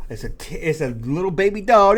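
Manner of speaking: ordinary talk, nothing marked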